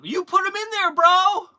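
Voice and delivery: high, loud incredulous retort; indignant outburst at a helper; exasperated protest. Real, not acted. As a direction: throw the blame back, voice jumping up. Arguing with helper over missing items.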